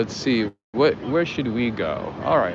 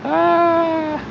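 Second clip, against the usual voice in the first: high squeal. Real, not acted. Exclamation after laughing.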